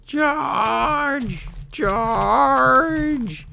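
high, drawn-out charge call; mock battle cry. Player leading an attack, drawn-out battle cry. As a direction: stretch the word like a bugle call.

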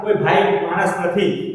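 ordinary talk, nothing marked

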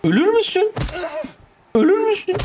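frantic shouting at a horror game monster; panicked shout. Real, not acted. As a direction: scream it at the thing attacking you.

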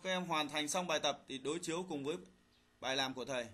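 ordinary talk, nothing marked

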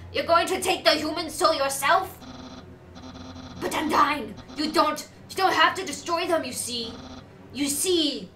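made-up character voice. Acted. Pleading with Undyne to spare the human, loud made-up voice. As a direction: protest loudly, stumbling over the plea. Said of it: "I'm just making up voices".

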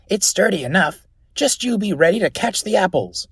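high-pitched lad calling from a tree; high, bossy youngster; confident boy. Acted. Cheeky boy up the tree answers.

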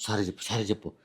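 low, soft conspiratorial coaching; hushed murmuring. Acted. Husband secretly coaches wife on apologising to his brother, low and hushed. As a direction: keep it down so nobody else hears. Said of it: "Gunna Gunna గుసగుస".

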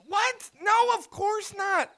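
ordinary talk, nothing marked

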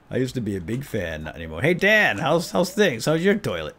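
ordinary talk, nothing marked

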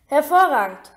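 loud, delighted praise; called out. A narrator's reading. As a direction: exclaim it to the whole class. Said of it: "rief Professor Lupin". (lauter, lobender Ausruf)

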